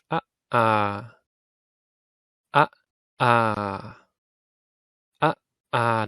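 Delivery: Ah short, ah held long; vowel pair short and long; short then long ah. Shown as a demonstration. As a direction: clip the first Ah, stretch the second ah. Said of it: "Say that with me".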